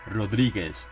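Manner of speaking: ordinary delivery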